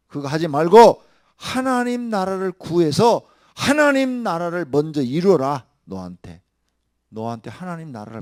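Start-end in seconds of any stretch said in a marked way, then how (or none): 0.1-5.6 loud, higher, slow exhortation; slow booming sermon command; fervent preaching. Acted. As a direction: urge them with full force. Preaching at full force.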